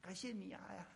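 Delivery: soft, half-hidden little acted prayer; small-voiced thanks. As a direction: imitate someone timidly thanking Jesus under their breath. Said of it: "小声的跟耶稣说".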